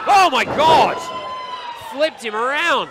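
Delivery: loud high shocked call; excited. Real, not acted. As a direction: shout in amazement. Commentator reacts to big move.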